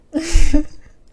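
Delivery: big laugh out loud; loud burst of laughter. Real, not acted. Laughing at bad result.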